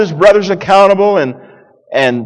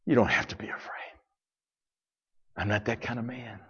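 soft whisper, gentle and close; hushed reassurance; 7 semitones lower, 17 dB quieter, faster than usual. Acted. Joseph whispering to his frightened brothers, soft reassurance.

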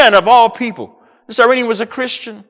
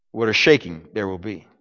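hushed; 11 semitones lower, 7 dB quieter than usual. Shown as a demonstration. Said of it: "repeated What a shaking".

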